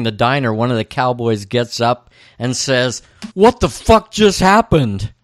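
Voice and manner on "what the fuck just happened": higher, louder mock disbelief; stunned outburst. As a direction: quote the cowboy, loudly baffled. Cowboy's stunned exclamation, quoted.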